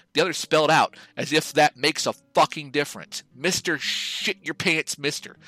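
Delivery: ordinary talk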